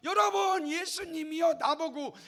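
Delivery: loud, high complaint to the crowd; hammed-up indignant wail; acted outrage. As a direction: cry out as if outraged and appealing to everyone. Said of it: "분노하고 화를 낼 수도".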